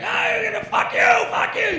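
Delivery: very high, loud screaming curses; drunk yelling. Retold or imitated. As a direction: scream it at the preacher. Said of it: "He's yelling at a preacher".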